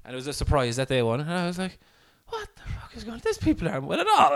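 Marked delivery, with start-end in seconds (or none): 2.3-4.3 high-pitched incredulous retelling; mock outrage breaking into laughter; laughing disbelief. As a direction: squeal it in amused bafflement.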